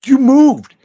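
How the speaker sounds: high, loud protesting yelp; indignant shout. Retold or imitated. Dad yelling his excuse at his son, loud and high.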